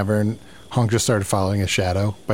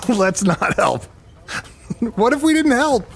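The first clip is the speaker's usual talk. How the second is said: giggling, pitched up high; laughing; 10 semitones higher than usual. Acted. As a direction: laugh through the question.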